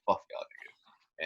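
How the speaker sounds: slow, hushed retold kiss-off; soft, drawn-out dismissal under the breath; dismissive mutter. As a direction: mutter it low as you walk off. Retells walking out on everyone.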